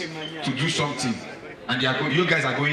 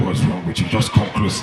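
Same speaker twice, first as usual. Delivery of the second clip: much lower seductive rumble; come-hither bass voice; dark deep voice; 8 semitones lower than usual. Retold or imitated. Michael urging him closer, dark and deep. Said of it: "the voice goes dark, deep".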